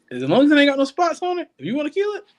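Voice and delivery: high-pitched, animated allowance; emphatic permission. Real, not acted. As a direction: pitch it way up, waving them through. Giving camp guests permission, high.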